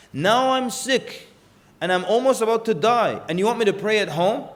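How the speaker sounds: impassioned protest. Retold or imitated. Dying father protesting to his sons in a pleading outburst. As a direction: plead that staying home breaks his lifelong prayer.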